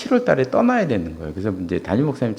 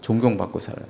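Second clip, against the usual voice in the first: ordinary talk, nothing marked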